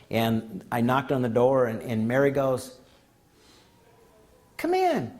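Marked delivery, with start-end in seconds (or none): 4.6-5.1 high voiced call from inside. Patient calling the therapist in, high pitched call. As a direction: sing out a high come in to the visitor.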